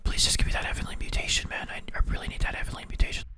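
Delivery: soft pleading whisper in the ear; right in your ear. Pretend. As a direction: whisper it close, begging. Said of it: "Let me whisper in your ear".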